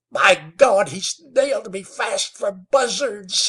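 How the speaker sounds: trapped man screaming as he realizes his fate; frenzied scream. A narrator's reading. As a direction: scream it in sudden terror. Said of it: "he screamed in sudden awful frenzy".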